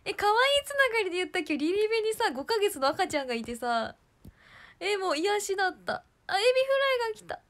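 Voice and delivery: high, loud giddy chatter; bubbling over with joy. Real, not acted. Gushing to viewers about the baby.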